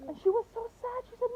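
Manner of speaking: ordinary delivery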